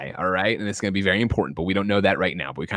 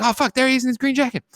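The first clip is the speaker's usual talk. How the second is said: startled yelp; 14 semitones higher than usual. Retold or imitated. Spotting the shooter, retold.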